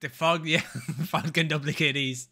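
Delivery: normal talking voice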